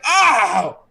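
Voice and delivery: high startled oh; shocked gasp. Acted. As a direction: gasp at being clawed. Guy slashed across the chest.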